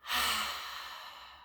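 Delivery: a long airy sigh, barely voiced; breathy exhale sigh. Shown as a demonstration. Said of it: "One more time".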